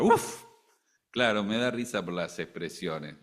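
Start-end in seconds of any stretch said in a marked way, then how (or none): 0.0-0.4 a sudden high, loud whoosh of milk boiling over. Pretend. Preacher mimicking milk boiling over for the congregation, high sudden whoosh. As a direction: burst out with the sound of milk spilling over the pot.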